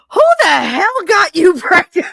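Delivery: loud, high mock yell; imitating a friend's shrieked question; shocked outburst. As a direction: burst out in disbelief, breaking into a laugh.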